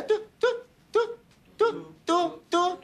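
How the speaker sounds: very high imitated card-reader beeps; bold beeping. Shown as a demonstration. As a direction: beep boldly for the group to copy. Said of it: "大聲啲". (高音大膽模仿拍卡嘟聲)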